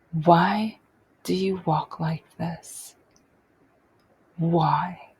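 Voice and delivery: slow, measured imitation of a stern mother; restrained mock scolding, each phrase set apart; toned-down harsh mother. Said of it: "not even saying it as harshly as she does".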